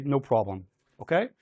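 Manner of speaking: ordinary delivery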